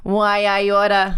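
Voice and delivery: slow, drawn-out forties gangster; hammed-up old New York mobster drawl; mobster murderer voice. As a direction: play a vintage mobster, stretching every word. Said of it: "my mobster murderer voice".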